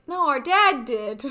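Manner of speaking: slow, put-on childish reply; kid voice. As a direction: drawl it like a smug kid. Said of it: "And he's like".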